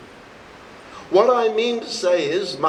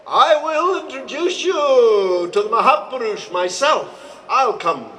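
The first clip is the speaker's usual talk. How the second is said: voicing the guru; 5 semitones higher, 3 dB louder than usual. Guru voiced in a teaching story.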